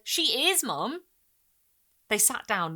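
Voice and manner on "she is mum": put-on insistent boy. A narrator's reading.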